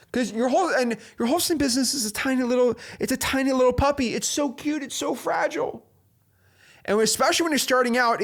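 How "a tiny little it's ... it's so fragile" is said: cutesy gushing. Pretend. Cooing over the tiny puppy.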